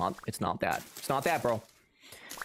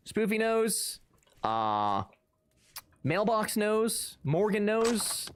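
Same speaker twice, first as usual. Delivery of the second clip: slow sing-song; 7 semitones higher, much slower than usual. Real, not acted. Naming who agrees.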